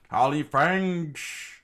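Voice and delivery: high, loud, slow drawn-out yell; battle cry. Acted. Chaka yelling his attack as he swings at Crocodile. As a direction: roar out the attack name.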